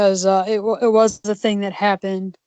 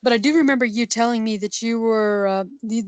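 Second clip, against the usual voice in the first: ordinary delivery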